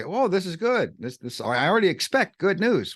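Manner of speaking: high, eager oh this is good; delighted. Pretend. Reader discovering good news, delighted. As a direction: sound pleasantly surprised.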